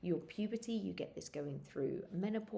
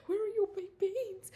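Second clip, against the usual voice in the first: much higher, louder frantic demand; high frantic plea to the stock clerk; desperate demand; 14 semitones higher, 6 dB louder than usual. Retold or imitated. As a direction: demand the beans like a crazed woman. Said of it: "I'm like".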